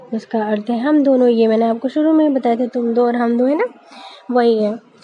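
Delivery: ordinary talk, nothing marked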